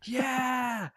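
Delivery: high, drawn-out cheer; wild fan cheer. Acted. Game master plays Crab cheering wildly, high fan yell.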